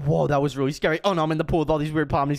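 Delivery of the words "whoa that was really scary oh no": normal talking voice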